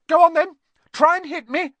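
very high, loud dare; defiant challenge. A narrator's reading. Neville raising his fists at Ron, defiant dare. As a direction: goad them, pitch sky-high.

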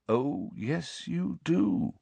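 put-on gentle old headmaster, unshaken. A narrator's reading. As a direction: answer the scream very calmly. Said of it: "still more calmly".

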